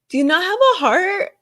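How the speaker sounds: high, incredulous, exasperated cry; outraged disbelief. Real, not acted. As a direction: demand it of the family in disbelief. Outraged at [name]'s family.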